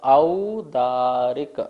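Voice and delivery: औदारिक drawn out very slowly with a full औ diphthong; teacher modelling औदारिक with a pure औ; clear au sound. Shown as a demonstration. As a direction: stretch the औ in औदारिक. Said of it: "औ बोलना है".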